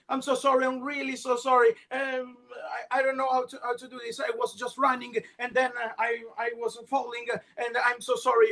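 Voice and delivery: high, sorry little voice; Blue apologising after crashing into them; apologetic Blue. Acted.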